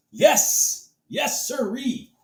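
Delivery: high, slow gleeful yes; gleeful celebrating. Real, not acted. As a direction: savour the victory. Celebrating the win.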